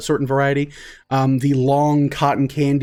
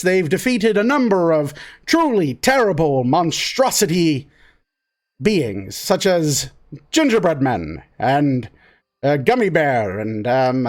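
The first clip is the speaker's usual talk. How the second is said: lively raised storyteller voice; dramatic recap narration; 4 semitones higher than usual. Acted.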